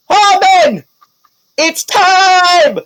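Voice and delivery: very high loud rallying shout; battle cry. Acted. Pete shouting to Hallman, very high battle cry. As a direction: call out the transformation. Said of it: "you hear him go".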